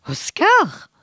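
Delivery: a high, loud, stunned Oscar?. A narrator's reading. Nils shocked that the pig is Christmas dinner, high and stunned. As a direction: gasp it out in disbelief. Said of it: "Nils le regarda stupéfait".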